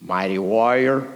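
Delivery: deep, solemn angel's call; mysterious heavenly voice. Acted. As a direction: intone it low, as a voice from nowhere. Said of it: "had you heard that voice".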